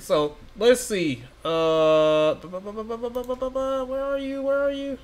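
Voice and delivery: ordinary delivery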